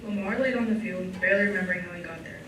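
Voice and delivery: ordinary talk, nothing marked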